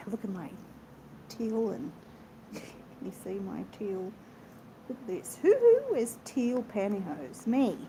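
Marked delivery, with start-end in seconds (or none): 0.1-5.4 much softer, partly whispered; soft showing-off. Real, not acted. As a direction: hush it like a secret reveal. Showing off outfit softly.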